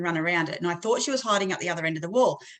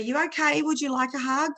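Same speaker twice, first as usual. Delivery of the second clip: higher-pitched coaxing question; gentle soothing offer; 7 semitones higher than usual. Retold or imitated. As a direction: offer comfort to an upset child. Soothing a crying child, retold.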